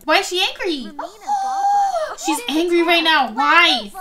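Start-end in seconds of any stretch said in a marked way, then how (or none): 0.9-2.2 high, drawn-out gasp; shocked gasp. Real, not acted. Shocked that child is angry.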